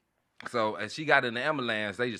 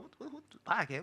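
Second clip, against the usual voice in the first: startled self; 10 semitones higher, 9 dB quieter than usual. Retold or imitated. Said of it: "I'm like".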